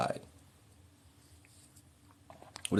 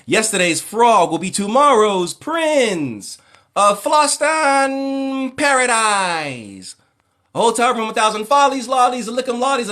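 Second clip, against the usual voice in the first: high, loud, hyped-up radio host squeal; over-the-top celebrity host voice, high and slow; Chris Tucker as radio host; 11 semitones higher, 3 dB louder, slower than usual. Retold or imitated. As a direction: shriek it like a flamboyant showbiz DJ. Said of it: "I loved Chris Tucker".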